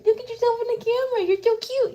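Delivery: high-pitched doting coo; pet talk. Real, not acted. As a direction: fawn over a cat in a very high voice. Cooing at cat on camera.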